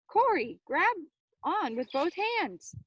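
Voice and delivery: high, loud and urgent; urgent shout. Acted. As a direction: yell it as he slips. Corey lost his grip.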